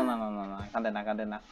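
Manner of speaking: put-on soft, low soothing to a crying baby; soothing hush. Player hushing the crying game baby, softly.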